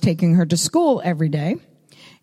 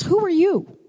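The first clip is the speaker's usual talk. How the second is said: a much higher, surprised "Who are you?"; surprised question; 10 semitones higher than usual. Retold or imitated. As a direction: ask it with high-pitched amazement. Woman asks the stranger.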